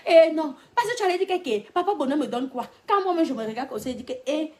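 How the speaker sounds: high, loud, indignant imagined argument; worked-up outburst. Acted. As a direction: act out being carried away, loud and high. Imagined self-talk, acted excitedly.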